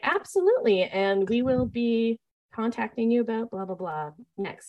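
mock-polite call with a parent, trailing off into blah blah; bright customer-service voice. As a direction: sound overly professional for the parents. Back to phone with parents.

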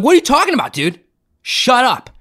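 high-pitched, indignant comeback; irritated, high-voiced brush-off; mock angry disbelief. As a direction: imitate someone refusing to believe it. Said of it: "He got so mad".

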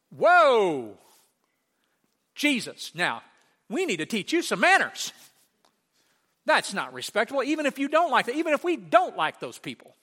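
loud, high mock outrage; indignant scolding. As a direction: scold him as a shocked onlooker. Acting out indignant reaction to Jesus.

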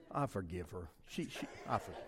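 low, very soft self-talk; subdued forgiveness. Acted. Driver forgiving the lady who annoyed him, subdued. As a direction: drop right down, say it to yourself.

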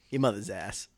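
imitating a Boston accent. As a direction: copy his Boston accent lightly. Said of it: "it's never not funny".

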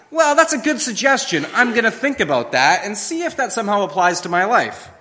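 high, breezy and dismissive; mock casual shrug. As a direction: toss it off like it's optional advice. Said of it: "we take them as".

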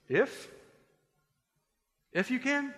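high rising echo question, long pause between; incredulous. Acted. Jesus answering the doubting father, incredulous questioning. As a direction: throw the doubt back in disbelief.

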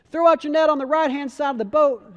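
high, loud shouted instruction; sustained holler from the shore; calling out. A narrator's reading. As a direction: project across the water to a boat. Jesus calls instructions to boat.

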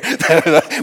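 loud high laugh; burst of laughter. Real, not acted. Laughs at own joke.

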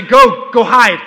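put-on laughing toddler demanding hide and seek. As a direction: bark it out like an eager two-year-old. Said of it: "he'll say".

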